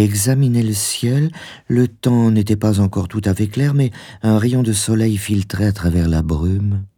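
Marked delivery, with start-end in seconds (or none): none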